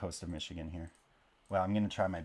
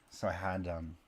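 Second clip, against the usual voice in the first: ordinary talk, nothing marked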